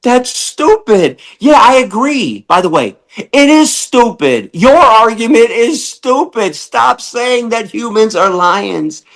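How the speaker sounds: high, heated, incredulous tirade; exasperated rant. Real, not acted. Host ranting at lion-argument commenters, high and exasperated. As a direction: vent at the absurd argument.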